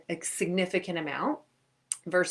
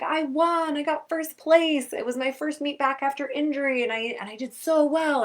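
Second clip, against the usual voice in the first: higher, breathless proud news; thrilled kids; 8 semitones higher, 3 dB louder than usual. Retold or imitated. Kids telling the coach about their wins, thrilled. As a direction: burst in with the good news. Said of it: "all these kids start filing in are like".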